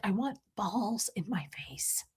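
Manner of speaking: breathy, slow whisper; whispered quote. Retold or imitated. Quoting a comedy character to chat, whispered. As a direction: whisper the line dreamily.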